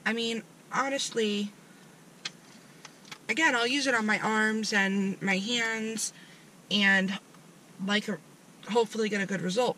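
through a gel sheet mask; talking with face mask on. Real, not acted. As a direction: mask clinging around mouth. Seen 2: Faces look glossy and covered; earlier frames show smoothing mask on.

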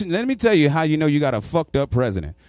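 ordinary talk, nothing marked

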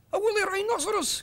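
high, loud panicked cry; alarmed cry. Acted. Tribesman warning the crowd, high panicked cry. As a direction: cry out in terror of a beast. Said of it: "cried one".

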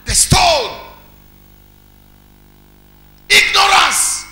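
high, loud preacher's roar; shouted preaching. Acted. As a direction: hurl the words at the congregation.

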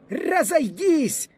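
very high, drawn-out command; officer's shout. A narrator's reading. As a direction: shout the order slowly, pitched far up. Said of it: "закричав офіцер". (високий протяжний окрик офіцера)

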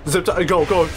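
ordinary talk, nothing marked

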